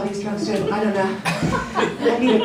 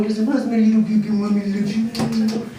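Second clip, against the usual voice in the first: put-on sweet baby talk. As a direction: coo lovingly at the newborn. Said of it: "I thought I would wake up every morning going".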